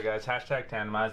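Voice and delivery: usual speaking voice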